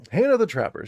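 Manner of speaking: loud, high announcer call of the name; dramatic announcement. Acted. Game master announces the new character, loudly. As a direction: proclaim the name like a herald.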